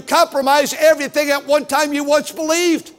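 loud, high pulpit shout; preaching at full force; fiery preaching. Acted. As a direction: rebuke the congregation with fire. Preaching climax.

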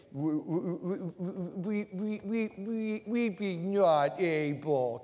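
low, soft, slow pout; pouty whine. Shown as a demonstration. Preacher showing the pouty version, low and slow. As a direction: push the lips out and whine. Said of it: "You gotta mean it now".

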